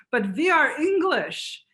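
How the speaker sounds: high, slow mock insistence; insisting refugees. Refugees assuring Welsh hosts, high slow mock insistence. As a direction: protest innocently that you're English. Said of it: "they were always saying".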